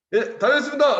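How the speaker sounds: high, loud, eager recruit; recruit's farewell. Retold or imitated. Recruit entering camp, loud mock-eager reply. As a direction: imitate a cheerful recruit calling out.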